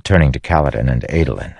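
ordinary delivery, nothing marked

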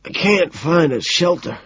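high, tearful strain; choked plea. A narrator's reading. Homeless man explains his plight, choked and pleading. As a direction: push it out through a tight throat. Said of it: "he chokes and swallows".

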